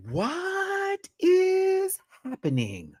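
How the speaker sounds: very slow, high stunned question; stunned disbelief. Retold or imitated. Host watching the fight, slow high stunned disbelief. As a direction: drag it out in disbelief. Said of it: "I'm like".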